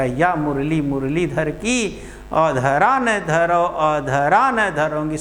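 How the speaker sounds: slow, measured verse reading; slow recitation. Shown as a demonstration. Teacher pointing out wordplay to students, slow recitation.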